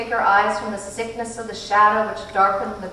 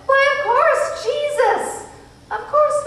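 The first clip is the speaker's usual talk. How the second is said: much higher, louder gleeful cry; delighted agreement; 6 dB louder, slower than usual. A narrator's reading. Missus Watson delighted with Charles Wallace, high and gleeful. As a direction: beam with joy at the answer.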